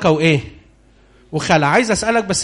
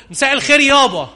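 Esau's greeting; 7 semitones higher than usual. Acted. Esau greeting his blind father.

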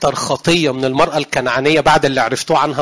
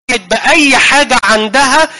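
emphatic challenge; 7 semitones higher, 5 dB louder, slower than usual. Real, not acted. Emphatic rhetorical question.

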